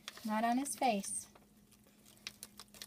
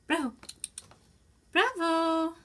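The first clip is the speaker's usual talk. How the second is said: high, louder call to the cat; 6 semitones higher, 4 dB louder than usual. Real, not acted. Mother calling the cat by name, high and loud.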